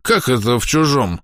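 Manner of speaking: put-on slow, slurred drunken protest; town drunk. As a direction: play a drunk old man protesting. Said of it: "пьяным голосом запротестовал Медников".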